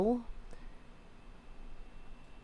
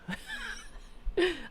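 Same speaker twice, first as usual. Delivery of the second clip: high laugh; 7 dB quieter than usual. Real, not acted. Laughing at own frustration.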